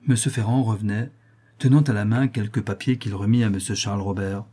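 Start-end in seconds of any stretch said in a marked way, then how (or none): none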